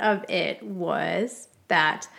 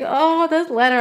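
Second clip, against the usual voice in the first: higher, louder mock wail; mock weeping; 6 semitones higher, 6 dB louder than usual. Wife mimics the weeping she didn't do, mock wail. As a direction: put on a weepy cry, hammed up. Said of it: "like, weeping".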